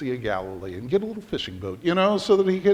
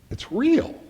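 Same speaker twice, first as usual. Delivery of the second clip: much higher, startled cry of real; shocked realization; 11 semitones higher than usual. Retold or imitated. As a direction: gasp it as truth hits. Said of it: "And we say".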